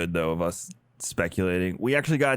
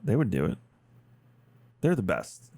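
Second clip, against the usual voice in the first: usual speaking voice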